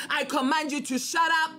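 ordinary delivery

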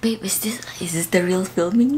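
unvoiced whisper. Real, not acted. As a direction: whisper it so the camera won't hear. Asks quietly if filming.

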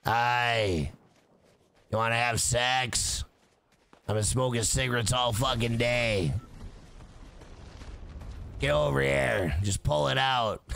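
low, mock husky phone sex operator. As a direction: play an unenthused, chain-smoking phone sex operator. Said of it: "Imagine if I was a sexual, uh, phone operator".

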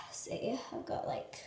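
breathy unvoiced whisper. Real, not acted. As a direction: whisper it, barely there. Whispering after cinnamon attempt.

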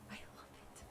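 barely voiced, very soft whisper; awed whisper. Real, not acted. Gamer awed by the lookout, whispering. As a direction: whisper it as if overwhelmed. Overwhelmed by the view.

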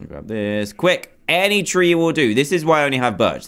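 ordinary talk

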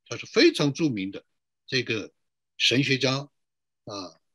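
ordinary talk, nothing marked

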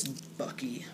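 whispered aside, barely there. Real, not acted. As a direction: breathe the name under the breath. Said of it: "unvoiced aside".

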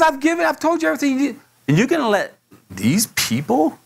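high, urgent scolding as God; exasperated rebuke. Acted. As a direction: press the reproach in an acted outburst. Acts God rebuking Moses.

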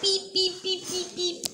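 high mouth beeps like a backing truck; reversing truck beeps. Acted. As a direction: beep beep like a vehicle reversing. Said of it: "All right, we're here".

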